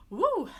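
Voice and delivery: loud, high celebratory "woo"; relieved whoop. Real, not acted. Relief at good test result.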